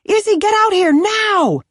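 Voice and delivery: high, loud urgent yell; yelling for help. Acted. Kenzie yelling for Izzy to come help, urgent. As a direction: call her out right now.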